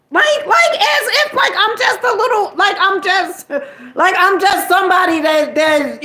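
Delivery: high, loud laughing outburst in disbelief; incredulous laughing shout. Real, not acted. Venting to viewers about the kids' father, laughing in disbelief.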